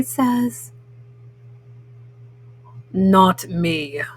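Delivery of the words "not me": much lower grumpy toad voice. Acted. Mom reading to kids, Grumpy Toad refuses, much lower and grumpy. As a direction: grumble it like a sulky old toad. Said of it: "says Grumpy Toad".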